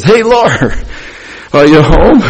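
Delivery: high, loud call through the door; husband calling out cheerfully on arriving home; excited greeting. Retold or imitated. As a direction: sing out his arrival to his wife. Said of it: "I'll open the door and I says".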